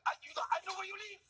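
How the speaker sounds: high, manic put-on threat; offended French meth addict. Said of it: "we've offended French meth addicts".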